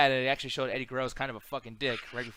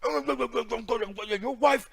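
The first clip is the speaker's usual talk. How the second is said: high, loud gravel-less Macho Man growl; 8 semitones higher, 6 dB louder than usual. Retold or imitated. Mocking Samoa Joe imitating Macho Man on SmackDown. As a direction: ham it up like Macho Man cutting a promo. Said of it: "he turns into Macho Man".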